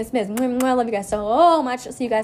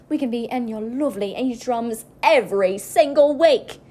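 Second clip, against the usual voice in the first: put-on Australian accent. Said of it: "that's Australian".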